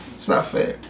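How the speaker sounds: breathy whispered sulk; a hushed muttered complaint. Real, not acted. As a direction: whisper it to yourself, sulking.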